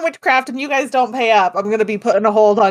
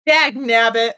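mock Oklahoma farmer accent; hammed-up country twang; slower than usual. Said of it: "put on your best country accent".

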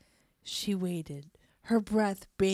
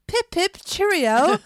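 high, loud hammed-up British accent; mock British accent; 10 semitones higher, 10 dB louder than usual. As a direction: toss it off like a cartoon English gent. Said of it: "all kinds of accents".

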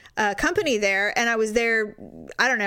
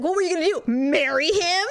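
higher-pitched taunt, last words stretched; mock incredulous challenge; 7 semitones higher than usual. Boyfriend challenging her over another man, mock incredulous. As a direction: imitate a scornful jealous challenge.

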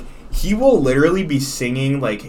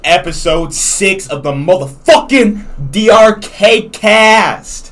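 hyped intro; 7 semitones higher, 10 dB louder, slower than usual. Real, not acted. Hyped podcast intro call-out.

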